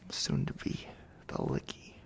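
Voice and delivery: whispered, creepy come-on; breath-only leer. Acted. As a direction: whisper it suggestively to a helpless captive. Leering at the captive.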